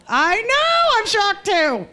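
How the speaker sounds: high, loud mock surprise; mock shock. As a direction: gasp it out like a fake shocked lady.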